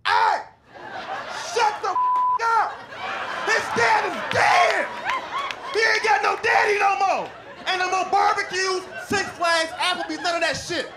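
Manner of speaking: high, loud mock yell at the class; student's shout. Student yelling at noisy class to hush, high mock shout.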